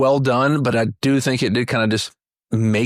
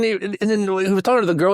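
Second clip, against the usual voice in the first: normal talking voice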